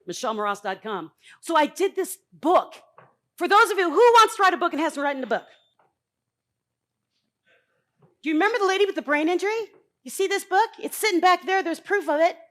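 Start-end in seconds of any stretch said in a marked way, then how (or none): 1.4-12.4 loud, higher, calling out to the room; rallying call. Real, not acted. Speaker calling out to audience about her book. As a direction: pump up the crowd.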